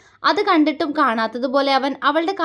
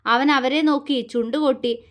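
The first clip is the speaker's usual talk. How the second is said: ordinary delivery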